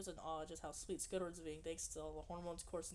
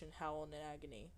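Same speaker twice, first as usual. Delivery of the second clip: ordinary talk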